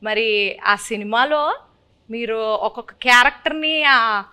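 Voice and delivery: host in a put-on Srikakulam village accent; playful Srikakulam dialect, lightly done; Srikakulam slang. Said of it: "కొంచెం Srikakulam slang బానే ఉందండి".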